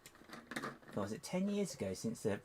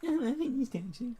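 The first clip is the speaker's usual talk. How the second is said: high loud retort; 14 semitones higher, 7 dB louder, faster than usual. Real, not acted. Loud high self-answer.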